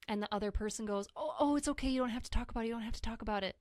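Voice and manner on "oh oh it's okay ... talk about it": quick, higher-pitched hushing-away of the topic; hasty "oh, it's okay" fussing; uneasy reassurance. Retold or imitated. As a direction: imitate someone nervously backing off with reassurances. Acting out an uncomfortable person's reassurance.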